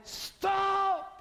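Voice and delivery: high sharp shout of stop; a startled, urgent high shout; sudden shout. Retold or imitated. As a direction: yell it to halt everyone at the cliff edge. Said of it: "Bob went, like that".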